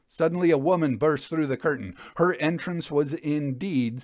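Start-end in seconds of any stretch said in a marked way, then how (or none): none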